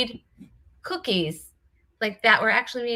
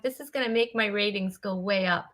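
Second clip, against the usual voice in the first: usual speaking voice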